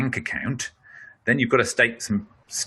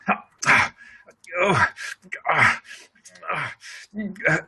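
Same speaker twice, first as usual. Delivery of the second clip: short, raised caught-breath gasps; imitating frustrated breath holds; 8 semitones higher than usual. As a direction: mime someone choking back their breath. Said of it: "people who are frustrated, they're going".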